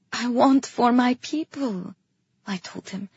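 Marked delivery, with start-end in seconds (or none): none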